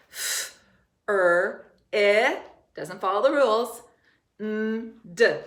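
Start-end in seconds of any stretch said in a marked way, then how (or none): 0.1-2.4 "F, er, eh" as separate drawn-out phonics sounds; sounding out. Shown as a demonstration. Teacher breaking friend into sounds, sounding out. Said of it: "It says eh". 4.4-5.4 "N, d" as a hummed n and a short d; sounding out. Shown as a demonstration. Teacher finishing the word friend, sounding out. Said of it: "How many syllables do we hear?".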